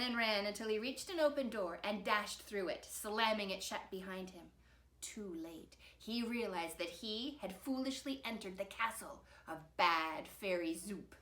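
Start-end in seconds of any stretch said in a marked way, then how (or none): none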